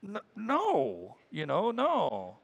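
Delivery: stalling, drawn-out, unconvincing no; pause, stumble, then stretch out the no; reluctant friend fudging the answer; hesitant denial. Acted. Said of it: "look for is the pause".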